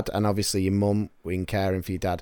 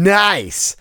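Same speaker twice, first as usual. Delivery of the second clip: upbeat retold reaction, pitched higher; mock cheery "Nice"; 7 semitones higher than usual. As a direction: replay a chirpy, clueless "Nice!". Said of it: "and I've gone".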